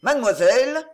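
loud, rising shouted order; angry shouted command. A narrator's reading. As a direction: force him to repeat it, loud. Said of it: "commanda-t-il".

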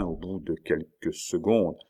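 ordinary delivery, nothing marked

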